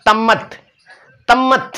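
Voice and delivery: तम्मत with च turned into त; child's lisp. Retold or imitated. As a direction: lightly mimic a toddler saying तम्मत for चम्मच. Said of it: "चम्मच को क्या बोलेंगे".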